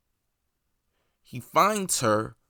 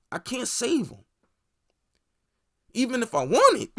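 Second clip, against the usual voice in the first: higher, louder mock Homelander refusal; cold refusal; 10 semitones higher, 4 dB louder than usual. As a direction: push it up high and hard, shrugging it off.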